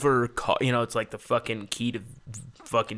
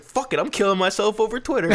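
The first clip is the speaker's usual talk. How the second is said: mock reckless outburst; 9 semitones higher, 5 dB louder than usual. Reenacting his own wild rant.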